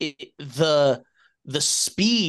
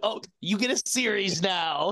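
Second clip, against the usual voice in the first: higher-pitched mock giveaway offer; lightly put-on, high-pitched imitation of studio bosses; mock studio announcement; 7 semitones higher than usual. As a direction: hand it out like a silly surprise prize. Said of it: "and they were like".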